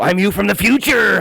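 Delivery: high, stretched-out shout; calling out in passing. Retold or imitated. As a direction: yell it as you whiz by. Heckles kid while skating past.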